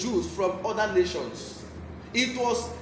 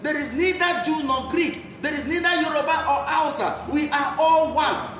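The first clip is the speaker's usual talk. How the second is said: fervent preaching; 3 semitones higher than usual. Acted. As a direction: preach it hard to the flock. Preacher exhorts the church.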